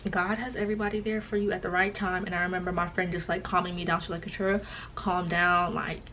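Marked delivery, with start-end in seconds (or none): none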